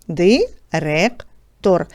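very slow, spelling out Директор syllable by syllable; teacher drawing out Директор slowly for sound analysis. Shown as a demonstration. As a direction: stretch Директор out slowly, each sound clear for the class. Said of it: "Виконаємо звукобуквений аналіз цього слова".

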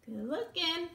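ordinary delivery

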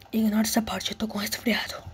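whisper. Real, not acted. Aside while apologizing for cold.

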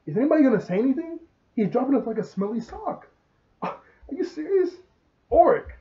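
hammed-up exasperated friend; mad outburst. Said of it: "Jeremy's the only one that's really getting mad".

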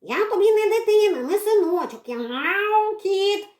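high, loud, meowing yell; shouting cat. Acted. Cat shouts protests at the girl. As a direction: yowl it across the whole yard. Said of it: "на весь двір кричав кіт".

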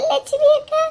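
high, wailing sob on the name; wailing cry. Acted. Woman wailing the name to Selva at the hospital. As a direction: break into a mourning wail. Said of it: "ஒப்பாரி வச்சுட்டு இருக்கா".

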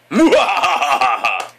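loud, slow mwahaha cackle; big put-on villain laugh; mock evil laugh.

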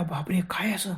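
hushed, breathless gasp of fear; terrified whisper. Acted. Sanju whispering in terror as ghost attacks.

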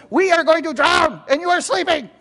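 very high, agitated cry; Peter's panicked outburst. Acted. Peter to sleeping Jesus in the storm, high panicked outburst. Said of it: "don't you think that's how Peter is?".

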